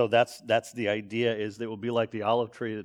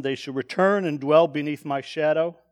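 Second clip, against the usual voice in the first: verse read out higher and louder; a raised, ringing scripture read; 5 semitones higher, 6 dB louder than usual. Shown as a demonstration. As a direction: proclaim the verse boldly to the room. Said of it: "again, because God's shadow is protecting them".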